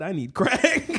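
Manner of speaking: loud, high punchline breaking into laughter; laughing joke. Pretend. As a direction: joke then crack up. Joking as an addict.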